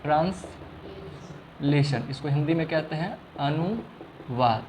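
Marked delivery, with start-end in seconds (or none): 0.0-2.0 translation drawn out very slowly and softly; धीरे-धीरे, हर ध्वनि अलग; slow, syllable by syllable. Shown as a demonstration. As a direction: spell out translation slowly, each sound clear. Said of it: "जिसको हम लोग कहते हैं translation".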